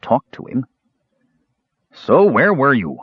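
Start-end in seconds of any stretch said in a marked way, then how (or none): 1.9-3.0 slow, higher, insistent question; demanding. A narrator's reading. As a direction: demand it slowly and firmly. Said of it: "Caramon demanded".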